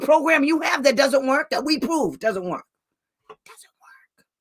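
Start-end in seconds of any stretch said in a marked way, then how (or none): 3.3-4.3 breathy whispered aside; hushed, unvoiced repeat. Real, not acted. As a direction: drop to a bare whisper.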